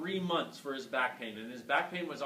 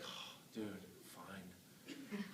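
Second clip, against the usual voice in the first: resigned sigh; 10 semitones lower, 17 dB quieter, much slower than usual. Retold or imitated. Resigned reply to patient.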